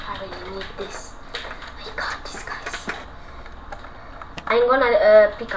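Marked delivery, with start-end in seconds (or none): 0.1-3.0 unvoiced hush to the viewers; whisper. Real, not acted. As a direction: whisper so granny won't hear. Whispering to avoid granny hearing.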